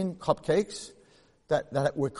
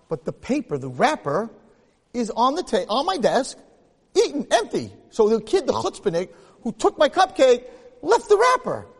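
rising outrage; 8 semitones higher, 7 dB louder than usual. Retold or imitated. Rabbi recalling finding his eaten cupcake, mounting outrage. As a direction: pitch climbs higher and louder with each phrase up to a near-shriek.

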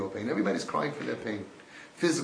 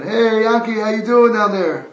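loud, high scream into a pit; 8 semitones higher, 12 dB louder than usual. Acted. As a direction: yell it down as loud as you can. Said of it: "You scream down".

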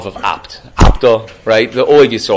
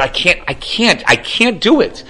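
anguished refusal; 8 semitones higher, slower than usual. Retold or imitated. Rich man refuses the demand.

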